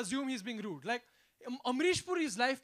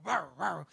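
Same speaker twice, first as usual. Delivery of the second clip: dog bark; 9 semitones lower than usual. Retold or imitated. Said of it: "this dog starts chasing".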